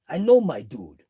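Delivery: loud New York accent. Shown as a demonstration. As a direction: punch it out like a New Yorker. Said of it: "gotta throw in the New York accent".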